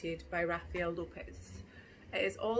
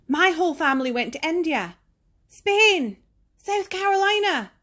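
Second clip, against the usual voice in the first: higher and louder, rising to a bragging peak on the places; excited boasting; 10 semitones higher, 17 dB louder than usual. Acted. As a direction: show off the trip list like an eager child. Child boasting about travels.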